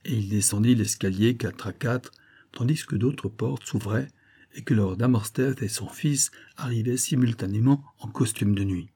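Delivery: plain reading voice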